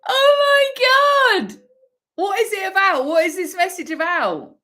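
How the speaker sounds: high-pitched tearful exclaiming; real astonished wail; overwhelmed amazement. Real, not acted. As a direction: cry out in disbelief, voice way up. Overwhelmed by the card.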